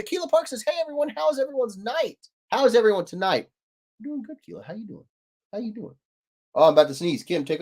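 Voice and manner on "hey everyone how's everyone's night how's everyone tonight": high greeting voice. Retold or imitated. Reading chat greeting aloud.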